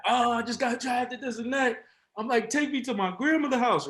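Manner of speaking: high-pitched elated hollering; excited yelling. Retold or imitated. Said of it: "immediately start yelling".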